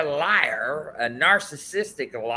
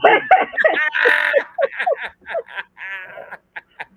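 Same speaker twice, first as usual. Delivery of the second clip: long, high cackling laugh; long loud laugh; 12 semitones higher, 5 dB louder than usual. Real, not acted. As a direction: burst out laughing at your own joke. Laughs at own joke.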